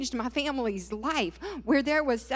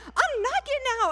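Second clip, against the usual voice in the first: high, louder mock argument; defiant retort; 13 semitones higher, 5 dB louder than usual. Wife answering husband in their fight, acted defiance. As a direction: refuse loudly, pitched right up.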